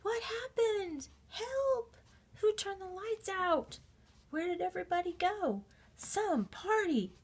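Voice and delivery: lost and frightened under a mountain of popcorn; a little bear trapped and calling for help; panicked cries. Acted. Sam buried under popcorn, panicking.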